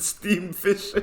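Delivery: steam fish squeezed out high through laughter; laughing. Real, not acted. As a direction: barely get it out for laughing. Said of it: "That's it".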